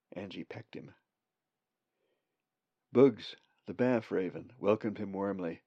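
plain reading voice

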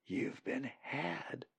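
slow, hushed warning; whispering. A narrator's reading. Molly warns Colin he was tricked, whispering. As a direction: drop the level and confide it slowly. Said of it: "Molly whispered to Colin".

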